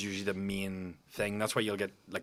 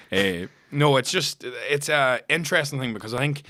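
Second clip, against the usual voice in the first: ordinary talk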